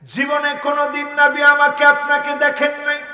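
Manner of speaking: high, sustained sermon chant; impassioned chanting preacher; melodic preaching. Real, not acted. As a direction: intone each phrase high and let it ring.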